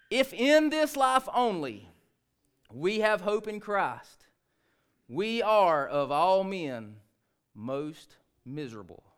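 grave verse reading that fades to a hush; solemn scripture reading. Shown as a demonstration. Slow solemn first verse reading.

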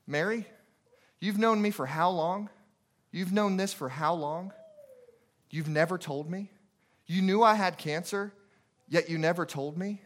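ordinary delivery

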